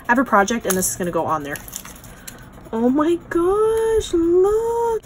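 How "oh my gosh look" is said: high, slow delighted squeal; much higher gushing oh my gosh; excited squeal. Real, not acted. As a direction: squeal with delight at something tiny. Gushing over tiny craft.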